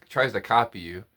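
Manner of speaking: normal talking voice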